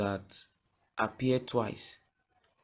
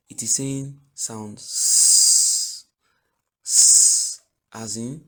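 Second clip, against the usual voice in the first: slow, loud "sound s"; emphatic slow; 8 dB louder, much slower than usual. Shown as a demonstration. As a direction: drag out "sound s" to stress it. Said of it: "Instead of saying sound k".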